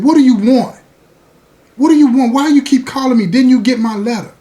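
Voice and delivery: loud, high, exasperated demands; angry girlfriend. Retold or imitated. Girlfriend fed up with repeated calls, loud angry. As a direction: snap at him for calling. Girlfriend snaps at him on phone.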